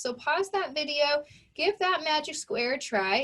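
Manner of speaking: usual speaking voice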